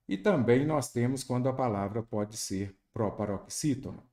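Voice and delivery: usual speaking voice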